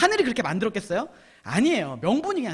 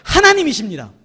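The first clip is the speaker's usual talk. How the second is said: loud, measured proclamation; call to repeat; 5 semitones higher, 10 dB louder than usual. Shown as a demonstration. Preacher leading the congregation to repeat after him, loud proclamation. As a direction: project each phrase for a crowd to echo. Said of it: "따라해봅시다".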